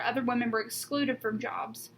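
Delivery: usual speaking voice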